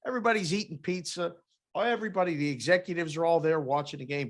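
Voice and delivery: ordinary talk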